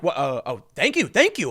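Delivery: mock flustered thank-you; shocked thanks. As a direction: play a driver taken aback by a huge tip. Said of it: "the dude was shocked".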